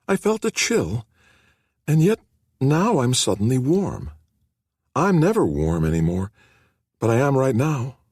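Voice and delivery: a hint of a shy sick teen, surprised; frail young woman. A narrator's reading. Nikki's voice in the story.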